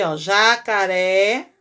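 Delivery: "jacaré" drawn out slowly, syllables split ja-ca-ré. Shown as a demonstration. Teacher splits the word into syllables for the pupils. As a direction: spell out "jacaré" slowly, stressing the last syllable. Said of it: "acento agudo no é".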